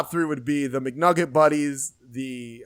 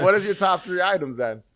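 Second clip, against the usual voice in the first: ordinary delivery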